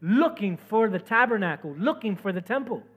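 loud, emphatic sermon delivery; fervent preaching. Real, not acted. Preacher urging people to look for the temple, fervent. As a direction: preach it hard, punching the key words.

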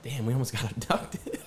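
laughing through the words; half-laughing, amazed; laughing disbelief. Retold or imitated. Storyteller laughing about almost getting abducted. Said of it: "I was like".

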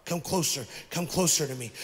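soft, low, tender invitation; gentle call. Acted. God inviting the seeker closer, soft and low. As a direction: draw them near softly. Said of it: "he's saying".

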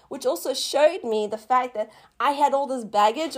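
ordinary talk, nothing marked

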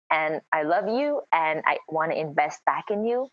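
usual speaking voice

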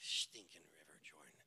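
unvoiced muttered aside; whispered grumble. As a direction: grumble it under your breath. Naaman grumbling under breath.

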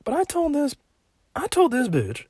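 high scandalized voice telling on his mom; high-pitched outrage. Real, not acted. Ranting about his mom.

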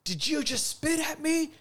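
high, sharp yelled challenge; heated call-out across the counter; retold yell. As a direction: confront him, a heated yell. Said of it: "And I, I yell it".

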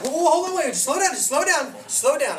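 high, loud, repeated protest; mock alarm. Hesitant churchgoer told to raise the dead, mock-alarmed protest.

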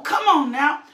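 usual speaking voice

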